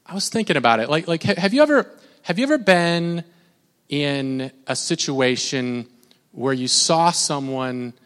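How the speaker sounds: ordinary talk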